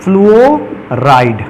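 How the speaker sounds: फ्लूओराइड stretched very slowly, each syllable spelled out; drawn-out ending. Shown as a demonstration. Teacher dictating the suffix to students, very slow and deliberate. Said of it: "तो इसको कैसे लिखेंगे".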